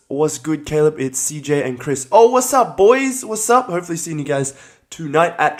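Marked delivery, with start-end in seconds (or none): 2.1-3.7 excited greeting. Real, not acted. Greeting friends joining the stream.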